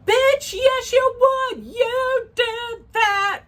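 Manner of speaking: very high, loud, drawn-out retort; mock-outraged squeal. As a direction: squeal the accusation, stretching the last words. Calls out the mistress's lie.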